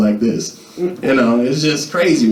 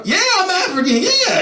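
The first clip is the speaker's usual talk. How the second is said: very high giggly yes; laughing eager yes. Retold or imitated. To the girl, a giggly squeaky eager yes. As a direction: blurt it with a giggle.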